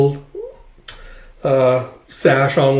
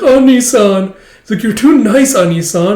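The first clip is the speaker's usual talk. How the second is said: much higher, louder adoring squeal; gushing juniors; 9 semitones higher, 7 dB louder than usual. Retold or imitated. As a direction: coo over the kind senior. Juniors gushing over senior.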